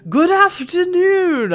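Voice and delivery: hammed-up British accent, loud and slow; fancier British accent. [name] greeting the silent inn in a fancy British accent. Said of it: "He raises his voice and speaks in a slightly fancier British accent".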